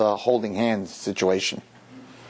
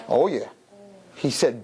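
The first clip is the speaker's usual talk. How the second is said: ordinary talk, nothing marked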